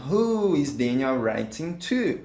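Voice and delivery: each word clear and unhurried; slow, careful English. Shown as a demonstration. Teacher to students, modelling the question slowly. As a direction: spell out the question slowly for learners. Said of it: "models the answer for students".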